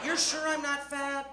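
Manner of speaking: slow, high-pitched, drawn-out doubt; insistent doubting child. Retold or imitated. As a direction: stretch the question out, pleading for the truth. Child asks again, more doubtfully.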